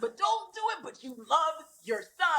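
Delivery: high-pitched begging; mock desperate plea; frantic pleading. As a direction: beg the hero to stop, hammed-up. Pleading with the film character.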